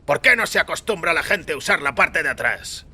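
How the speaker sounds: a hint of a fed-up rider; mild complaining voice; voiced complaint. A narrator's reading. Narrator voices grumbling passenger.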